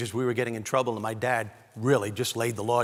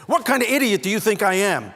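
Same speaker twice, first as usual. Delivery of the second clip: loud, raised-pitch mock outrage; angry father; 8 semitones higher, 8 dB louder than usual. Father to the son who lied to him, angry retold outburst. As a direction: thunder like an insulted dad. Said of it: "and he was angry".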